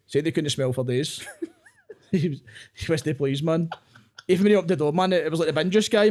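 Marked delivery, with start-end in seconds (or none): none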